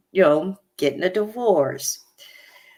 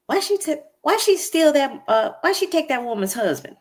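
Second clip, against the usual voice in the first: high, pointed, disbelieving question; incredulous mock question; 11 semitones higher than usual. Cast member grilling a new friend at dinner, high incredulous mock question. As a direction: pitch it way up like you can't believe it.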